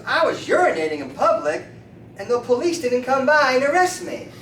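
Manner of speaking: rising to loud, high amazement; acted gleeful boast. Beer drinker boasts of escaping arrest, rising excitement. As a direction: build to an astonished high finish.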